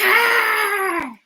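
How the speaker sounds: a small shouted grr, hammed up; mock tiger growl. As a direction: growl like a little mouse pretending to be fierce.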